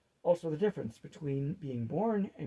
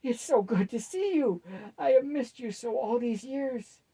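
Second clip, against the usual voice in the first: gushing; 8 semitones higher, 6 dB louder than usual. Acted. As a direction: pour out the joy of a reunited grandson. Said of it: "he gushed like the boy".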